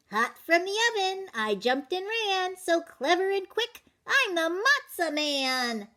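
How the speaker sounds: high, bouncy boastful chant; sing-song brag as the runaway cracker; cocky matzah man. Acted. Matzah man taunting the baker in a high boastful sing-song.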